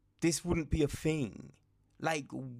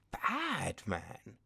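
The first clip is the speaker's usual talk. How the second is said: ordinary talk, nothing marked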